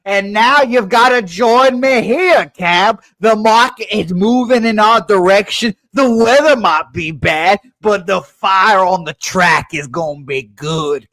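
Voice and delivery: a mock Southern preacher rallying his partner; Southern accent. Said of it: "accent again".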